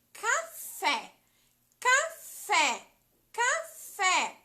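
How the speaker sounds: café with the last syllable fé pushed loud and high; stress on FÉ. Shown as a demonstration. Teacher sounding out café for children, fé stressed. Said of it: "Vamos pensar".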